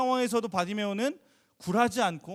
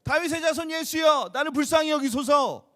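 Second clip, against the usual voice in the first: loud, higher pleading shout; desperate cry; 7 semitones higher, 6 dB louder than usual. Retold or imitated. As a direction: cry out like the blind beggar. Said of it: "배우가 너무 잘 표현한". (절박하게 높고 크게 외침)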